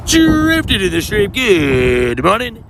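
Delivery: drawn-out high greeting; singsong greeting. Acted. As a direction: sing the hello out slowly. Streamer greeting a chat viewer.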